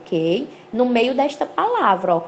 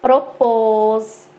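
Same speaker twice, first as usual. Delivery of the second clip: PROPÔS with a long, held final PÔS; stress on last syllable; 4 dB louder than usual. Shown as a demonstration. Teacher gives an oxytone example to students, holding the end of PROPÔS. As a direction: draw out the second syllable of PROPÔS. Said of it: "a sílaba tônica é a última".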